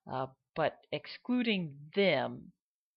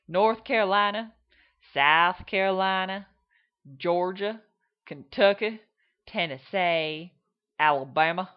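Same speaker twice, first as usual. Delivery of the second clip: loud Southern drawls state by state; Southern drawl demo, state by state; Southern accents in a row; 4 semitones higher, 9 dB louder than usual. Shown as a demonstration. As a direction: run through each Southern state's drawl. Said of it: "what would be called a Southern drawl".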